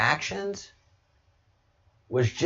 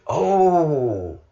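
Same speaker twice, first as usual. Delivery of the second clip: put-on a weak, half-hearted Scottish accent on "Oh"; 3 semitones higher, 4 dB louder than usual. Quoting the Scotsman to viewers in a mock Scottish accent. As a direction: attempt a Scottish "Oh" and miss the accent. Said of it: "I didn't even say that with a proper Scottish accent".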